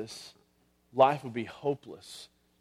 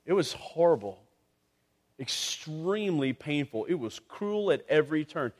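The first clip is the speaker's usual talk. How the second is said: ordinary talk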